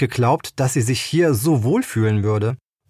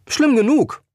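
curt retort; 12 semitones higher than usual. Acted. Woman snaps back at Tiller.

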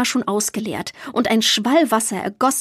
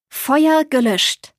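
hoch und langsam, zufrieden verkündet; erleichterter Troll; 5 semitones higher, 3 dB louder, much slower than usual. A narrator's reading. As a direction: stolz wie ein Waldwesen, das ein Feuer gelöscht hat. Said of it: "erklärte Borg erleichtert und sichtlich zufrieden". (erleichtert und zufrieden, hoch und gedehnt)

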